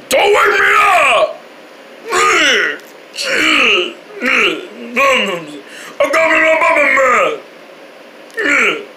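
high, slow wailing yell; wailing shout. Acted. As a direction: wail it like a tantrum. Wailing tantrum.

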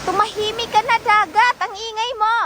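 hammed-up scolding of the sea; mock scolding. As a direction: tell off the noisy sea like a child. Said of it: "Ang dagat pinatahimik".

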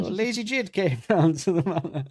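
high, prim posh lady voice; cartoon princess. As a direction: play a dainty cartoon princess. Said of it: "sounds like a princess in a kid's cartoon".

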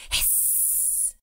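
pained hiss. Acted. Bat hurt by the light.

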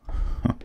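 short soft villain chuckle; small smug laugh; amused scoff. Acted. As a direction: let out one brief, quiet huff of laughter. Villain amused at hero's obedience.

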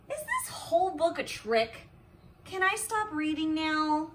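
plain reading voice

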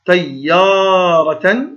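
very slow طيارة with yaa held long; long alif. Shown as a demonstration. Teacher showing pupils the madd letter, yaa drawn out in طيارة. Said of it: "الألف مد والياء".

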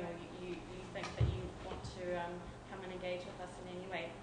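usual speaking voice

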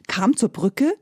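plain reading voice

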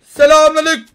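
high, loud mocking hello; yelled greeting. Real, not acted. Gamer loudly greeting an enemy he attacks, taunting. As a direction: shout the greeting as you jump him.

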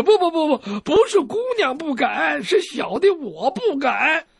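high, stuttering fearful correction, slowing at the end; flustered denial. Acted. Doctor correcting his slip to the angry girl, high flustered voice. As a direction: scramble to take it back. Captive flusters over wording.

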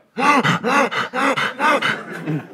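huge exaggerated gasp in; loud gasp. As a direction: suck air in like a vacuum. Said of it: "Whoa, slow do a bit there".

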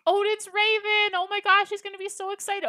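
light up with real excitement; excited. Real, not acted. Delighted at a viewer's suggestion.

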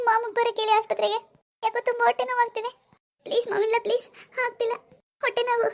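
high, strained voice crying out in pain; moaning in pain, pleading with her mother-in-law. Acted. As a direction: beg for help through stomach cramps. Acted stomach pain, begging help.

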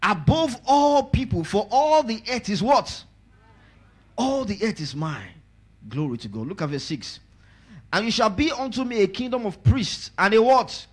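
high, loud call-and-response preaching; preaching at full force. Acted. Pastor calling the congregation to answer, full-force preaching. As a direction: lift the crowd on every phrase.

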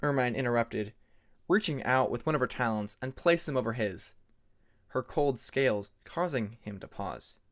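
usual speaking voice

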